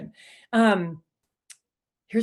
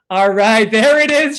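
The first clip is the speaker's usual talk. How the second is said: much louder, a victorious all right; triumphant cheer; 11 dB louder than usual. Real, not acted. Host cheering as the chat goes visible, triumphant. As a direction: celebrate the chat finally working.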